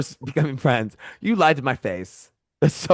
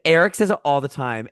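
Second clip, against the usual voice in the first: normal talking voice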